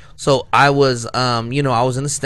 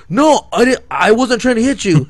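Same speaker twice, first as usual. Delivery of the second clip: mock defensive driver; 8 semitones higher, 5 dB louder than usual. Driver denying.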